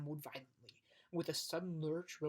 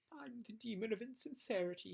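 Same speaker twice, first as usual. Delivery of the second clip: sobbing; 7 semitones higher than usual. Acted. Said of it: "he sobbed".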